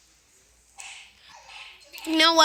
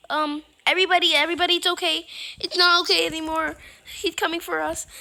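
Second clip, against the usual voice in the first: louder, fast panicky call; panicked; 4 semitones higher, 8 dB louder, faster than usual. Acted. Player warning everybody the monster is coming, panicked. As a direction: rush it out in alarm.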